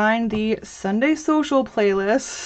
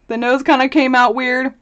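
ordinary talk, nothing marked

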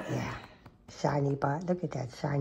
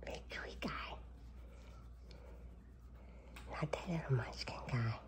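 much softer, breathy whisper; 3 semitones lower, 16 dB quieter than usual. Real, not acted. Owner leans in whispering to her sleepy cat. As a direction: coo it under your breath to the cat.

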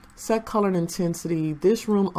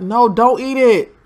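louder, slower shout of no, don't eat it; urgent warning; 5 semitones higher, 8 dB louder than usual. Real, not acted. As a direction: call out to stop him eating. Warning a Sim about spoiled food.

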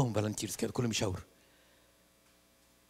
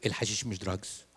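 lower, softer flat denial; mumbled denial; 6 semitones lower, 9 dB quieter than usual. Retold or imitated. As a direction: sullen mutter of denial. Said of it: "قال لي". (إنكار بصوت واطي)

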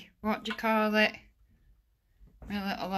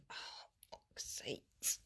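hushed swear under breath; whispered curse; 18 dB quieter, slower than usual. Real, not acted. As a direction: whisper it to yourself in pain. Whispered curse after falling.

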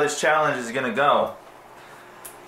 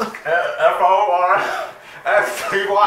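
slow; 4 semitones higher, 3 dB louder, much slower than usual. Real, not acted. Spelling while freezing.

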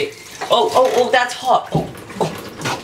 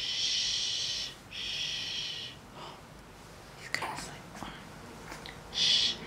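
whispered shh to settle a puppy; soft unvoiced shushing; hushed shushing; 17 dB quieter, slower than usual. Real, not acted. Whispering to a sleepy puppy in bed, hushed.

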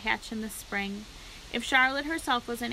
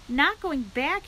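high, louder cry of disbelief; alarmed cry; 5 semitones higher, 5 dB louder, slower than usual. A narrator's reading. Pig startled by his friend's news, crying out. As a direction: cry out in dismayed surprise. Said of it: "he cried".

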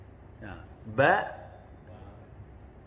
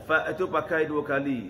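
ordinary talk

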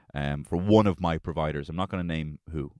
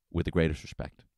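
Javier Bardem calm menace. Retold or imitated. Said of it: "harnessing a bit of Javier Bardem".

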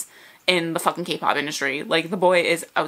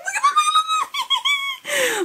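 squealing higher and higher with laughter; excited squealing; much faster than usual. Real, not acted. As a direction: squeal it out, building up to a shriek. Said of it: "I'm screaming".

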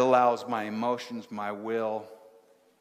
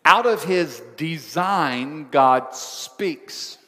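slow, louder solemn reading of "Out of his design, God speaks"; scripture quote; 6 dB louder, much slower than usual. Acted. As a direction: intone it like holy writ. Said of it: "let me quote scripture".

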